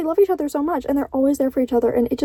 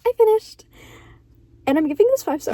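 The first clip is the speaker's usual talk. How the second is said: excited; 6 semitones higher than usual. Real, not acted. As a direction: announce it with a triumphant burst. Announcing finishing the book.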